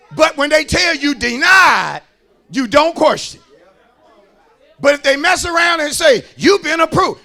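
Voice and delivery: loud, emphatic sermon delivery; full-force preaching. Acted. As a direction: drive each phrase home to the congregation.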